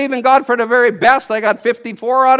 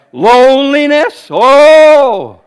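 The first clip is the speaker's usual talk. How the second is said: slow, loud, drawn-out Loneliness? then a big Oh!; loud mock surprise; 3 semitones higher, 8 dB louder, much slower than usual. Real, not acted. Preacher to congregation, loud scoffing cry. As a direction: cry out the word as if shocked. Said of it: "No loneliness in God's kingdom".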